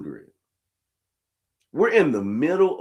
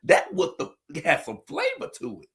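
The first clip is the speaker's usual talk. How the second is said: impressed praise; 4 dB quieter than usual. Retold or imitated. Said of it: "I said".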